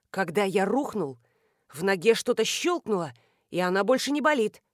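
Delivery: usual speaking voice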